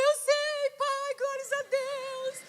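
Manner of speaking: very high mock-weeping thanks; drawn-out, high wailing praise; tearful joy. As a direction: squeal it through imitated tears. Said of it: "eu comecei a chorar".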